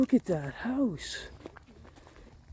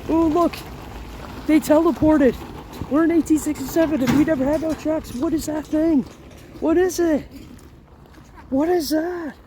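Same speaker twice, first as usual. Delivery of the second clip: high, loud astonished exclamations; shocked to see a modern truck; surprised; 7 semitones higher, 6 dB louder than usual. Real, not acted.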